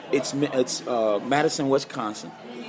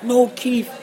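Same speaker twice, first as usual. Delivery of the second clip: high-pitched retold cry of dismay; imitated tearful protest; crying in disbelief; 8 semitones higher, 4 dB louder than usual. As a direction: plead with a dear friend, pitch way up. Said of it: "Oh, I'm crying".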